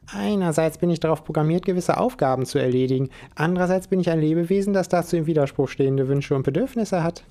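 a hint of a cheeky squirrel; squirrel Spike reporting. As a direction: report like a little agent robot.

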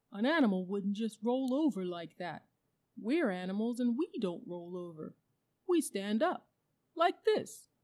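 lightly voiced bear making its case; demonstrative bear. A narrator's reading. Bear argues with the bulldog and shows off, emphatic.

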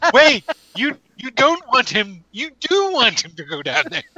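high-pitched comic character voice; voicing Three Toes. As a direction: pitch it up high in mock bewilderment. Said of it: "It's all like".

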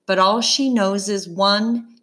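ordinary delivery

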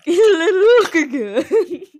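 high, loud laughing coo. Real, not acted. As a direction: squeal it through laughter. Echoing cute bug voice, laughing.

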